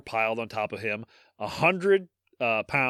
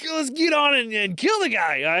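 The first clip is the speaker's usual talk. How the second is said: excited onlooker; 11 semitones higher, 4 dB louder than usual. Pretend. Imagined onlooker.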